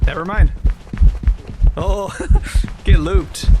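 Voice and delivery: loud, high triumphant yell; excited shouting. Real, not acted. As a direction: shout in gleeful disbelief. Escaping the ghost, excited yelling.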